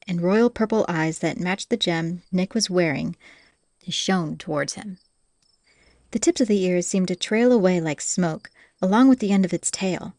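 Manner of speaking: ordinary delivery, nothing marked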